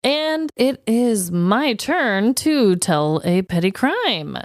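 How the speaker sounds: ordinary talk, nothing marked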